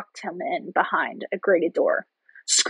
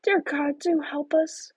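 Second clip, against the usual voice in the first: crying out, pleading; 6 semitones higher, slower than usual. A narrator's reading. Gretel lamenting as tears flow, high pleading. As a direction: plead helplessly in a small high voice. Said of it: "she cried".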